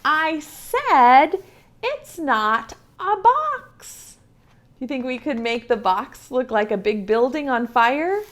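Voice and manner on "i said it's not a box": voice 5. A narrator's reading. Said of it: "And the bunny says".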